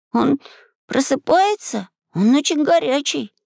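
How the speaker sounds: put-on high-pitched, marvelling boy; high boyish wonder; awed delight. A narrator's reading. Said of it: "восхищённо произнёс Максим".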